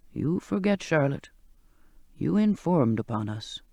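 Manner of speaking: put-on low old sailor, gently reproachful. Old cook reminding Charlotte she betrayed them. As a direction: remind her what she did.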